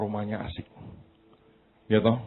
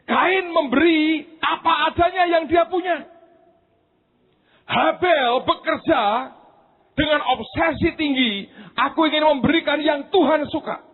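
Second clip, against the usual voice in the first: high, loud sermon climax; preaching at full force; 4 dB louder than usual. Acted. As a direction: preach it out with fire. (khotbah berapi-api, keras dan tinggi)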